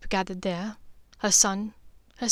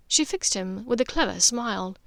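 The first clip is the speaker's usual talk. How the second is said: usual speaking voice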